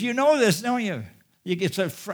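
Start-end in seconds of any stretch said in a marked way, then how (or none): 0.0-1.0 high, loud "You know this" with a pressing tone; frustrated appeal. Retold or imitated. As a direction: press them, a little exasperated. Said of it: "What he's really saying is".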